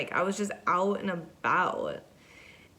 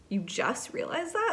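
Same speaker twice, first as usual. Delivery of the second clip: high disbelieving question; incredulous; 10 semitones higher than usual. Retold or imitated. To the woman who forgot to eat, incredulous. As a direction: ask it in sheer disbelief.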